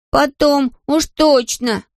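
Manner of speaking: put-on high-pitched excited child; childlike, high insistence; animated little boy. A narrator's reading. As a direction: blurt it like an agitated small boy. Boy Patrick exclaiming in story.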